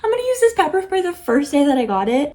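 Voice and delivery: high, loud rush of nervous excitement; jittery retold adrenaline; excited. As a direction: blurt it out, keyed up and fast. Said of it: "I grabbed my pepper spray".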